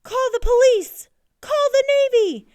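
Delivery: high, very loud cries for help; panicked shrieking. A narrator's reading. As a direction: raise the alarm at full volume. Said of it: "shrieked the coach".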